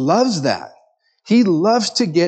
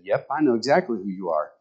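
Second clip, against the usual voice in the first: softer, lower and quick, totally unbothered; deadpan old man; 5 semitones lower, 7 dB quieter, faster than usual. Acted. Old man in the front pew answering Satan, deadpan and softer. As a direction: shrug it off flatly.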